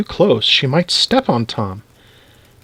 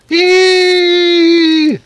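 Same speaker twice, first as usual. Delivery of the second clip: long, loud high-pitched squeal; high shriek; 5 dB louder than usual. A narrator's reading. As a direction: shriek "eee" in fright at a mouse. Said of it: "shouted Missus Newcomb".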